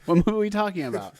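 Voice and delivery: normal talking voice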